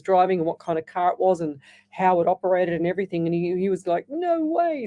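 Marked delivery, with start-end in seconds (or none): 4.1-4.8 retold scream, pitched up high; mock amazed scream. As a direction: imitate someone squealing in astonishment. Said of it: "screaming, you know".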